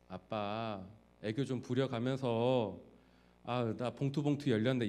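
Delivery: lightly voiced cute child pleading; a hint of a child acting cute for dad. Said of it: "애교 부리면서". (아빠에게 애교 부리는 아이, 살짝 흉내 내듯)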